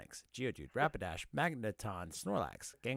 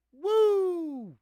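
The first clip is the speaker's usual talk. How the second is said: triumphant whoop; 9 dB louder than usual. Real, not acted. Cheers after finishing rap.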